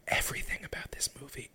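hushed, amazed whisper. Real, not acted. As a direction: breathe it out in disbelief. Awed whisper.

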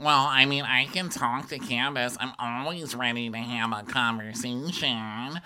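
mock Mia. Mia saying how she's feeling, mock wounded. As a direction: imitate a self-righteous, wounded complaint.

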